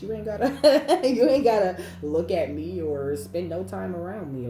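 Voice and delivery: loud, laughing, sassy brush-off to the haters. Real, not acted. Reader telling envious people to leave her alone, sassy and loud. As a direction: tell them off with a laugh.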